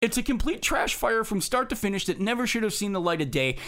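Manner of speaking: normal talking voice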